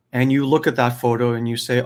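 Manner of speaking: usual speaking voice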